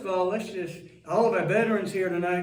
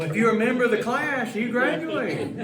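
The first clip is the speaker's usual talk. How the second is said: laughing tease; 6 semitones higher, 5 dB louder than usual. Real, not acted. Teasing the guest, laughing.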